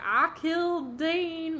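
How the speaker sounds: loud, high Texas twang; Texas accent. Retold or imitated. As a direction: stretch it out slowly in a thick Texas accent. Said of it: "that's his accent. Straight up".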